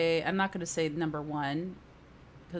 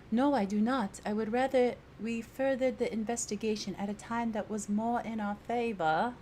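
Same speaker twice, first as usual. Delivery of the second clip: put-on higher, formal British-accented voice; 4 semitones higher than usual. Player voicing a captured companion, sudden British accent. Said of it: "She's turning British all of a sudden".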